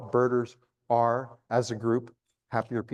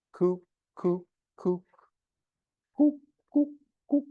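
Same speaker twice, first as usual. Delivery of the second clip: high coop, coop notes, climbing much higher; cuckoo call; 6 semitones higher than usual. Shown as a demonstration. Birder giving listeners the cuckoo call clue, high coop. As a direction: call out the bird saying its name. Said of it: "It's saying its name".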